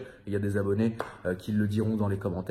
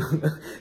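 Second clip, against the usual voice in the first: a quick amused laugh; brief real chuckle; short laugh; 8 semitones higher, 4 dB louder than usual. Real, not acted. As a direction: laugh at the idea. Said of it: "ça me fait rire".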